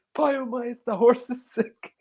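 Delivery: a hammed-up anxious Tony; mock worried Tony. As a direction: rush off in a fret.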